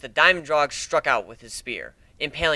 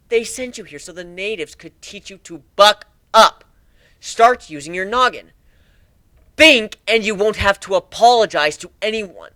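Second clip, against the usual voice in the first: loud, higher, slowed stern scolding; stern lecture; 6 semitones higher, 5 dB louder than usual. Acted. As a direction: lay down the law to the kid. Lecturing the colt as pirate.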